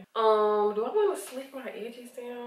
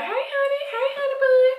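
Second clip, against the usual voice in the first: much higher, louder sweet country grandma call; imitating grandma; 9 dB louder than usual. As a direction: sing out like a fond grandma calling. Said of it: "She would always be like".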